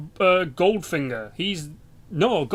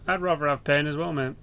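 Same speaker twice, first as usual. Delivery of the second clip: ordinary talk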